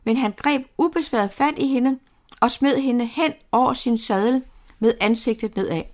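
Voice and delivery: usual speaking voice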